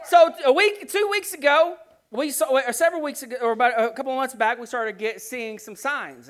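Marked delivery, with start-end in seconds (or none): none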